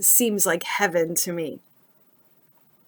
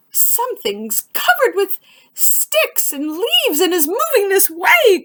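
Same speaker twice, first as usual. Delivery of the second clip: high, hurried squirrel voice; alarmed squirrel; 11 semitones higher than usual. Acted. Squirrel warns the animals, high alarmed chatter. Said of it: "cried a squirrel".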